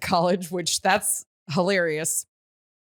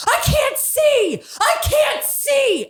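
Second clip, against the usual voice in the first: much higher, louder, slow panicked scream; 5 dB louder, much slower than usual. Retold or imitated. Retelling being hit at the game, panicked slow scream. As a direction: shriek it like you've been blinded. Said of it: "I start screaming".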